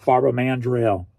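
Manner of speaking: usual speaking voice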